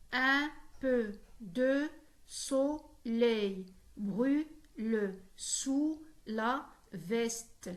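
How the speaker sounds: very slow, a gap after each syllable. Shown as a demonstration. Teacher demonstrating a ten-syllable verse, syllable by syllable. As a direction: sound out every syllable on its own. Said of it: "comporte dix syllabes prononcées".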